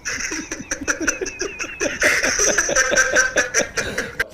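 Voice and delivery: long airy laughing fit; long breathless real laugh. Real, not acted. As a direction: crack up at your own joke. Teller laughs at own punchline.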